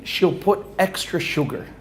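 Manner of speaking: ordinary talk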